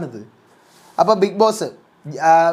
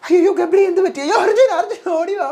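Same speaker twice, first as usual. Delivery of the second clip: high panicked mock cry; [name]'s alarmed call; 10 semitones higher than usual. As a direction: call for help in a pitched-up panic. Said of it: "പൂജ വിളിക്കുന്നു".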